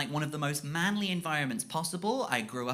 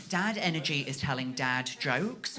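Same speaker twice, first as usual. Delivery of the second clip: ordinary talk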